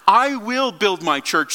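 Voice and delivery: "I will build my church" said slowly and firmly; emphatic statement. Retold or imitated. Preacher quoting Jesus' statement about his church, emphatic. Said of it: "emphatic statement that".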